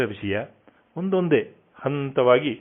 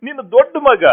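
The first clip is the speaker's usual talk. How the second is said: rising high and loud in grief; grief breaking through; 7 semitones higher, 3 dB louder than usual. Acted. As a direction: cry out to her, unable to hold it back. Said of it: "ದುಃಖ ತಡೆಯಲಾರದೆ".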